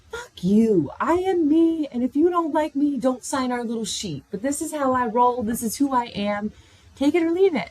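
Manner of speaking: loud, high, defiant outburst; defiant retort. Retold or imitated. Imagined retort to the cold resident, loud and defiant. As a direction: throw it back at her, proud and loud.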